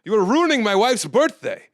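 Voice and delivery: high, loud scolding husband; angry heckler. Retold or imitated. Husband scolds the comic at his wife's birthday: high loud scolding. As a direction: scold him for ruining the birthday.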